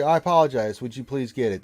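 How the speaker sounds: courteous, earnest asking; polite request. Shown as a demonstration. Streamer demonstrating the polite way to ask. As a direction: show the chat how to ask nicely. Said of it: "That's how you do that".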